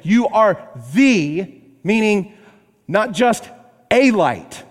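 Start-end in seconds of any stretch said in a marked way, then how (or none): 0.0-1.6 "the" drawn out, slow and loud; stress on the. Shown as a demonstration. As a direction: lean hard on "the" in "You are the". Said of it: "That article, the, is so important".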